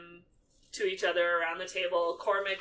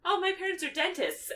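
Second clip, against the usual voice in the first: higher, chirpy Hermione; light schoolgirl voice, much higher; imitating Hermione; 12 semitones higher than usual. Hermione telling the table her parents' jobs, higher. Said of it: "she's like".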